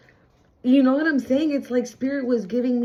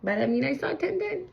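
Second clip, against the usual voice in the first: normal talking voice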